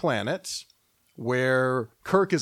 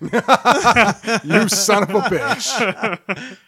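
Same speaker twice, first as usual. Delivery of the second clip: laughing outrage; 5 semitones higher, 5 dB louder, much slower than usual. Pretend. Mock outrage at shirt joke.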